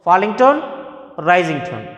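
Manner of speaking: ordinary delivery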